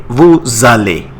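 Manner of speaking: Vous allez as vuz ale, z in the link; liaison. Shown as a demonstration. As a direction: repeat the z liaison clearly. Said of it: "The Z comes between S and A".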